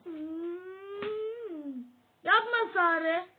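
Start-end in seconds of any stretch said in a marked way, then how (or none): 2.2-3.3 slow, loud protest while tickled; being tickled. Real, not acted. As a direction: squirm through the words. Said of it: "ayağımı gıdıklama Sare".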